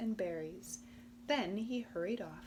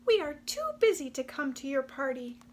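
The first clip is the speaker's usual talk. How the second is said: higher, fast busy little voices; hurried mice; 5 semitones higher, 7 dB louder, faster than usual. Acted. The mice turning Possum down, high and fast. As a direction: rush it, too busy to stop.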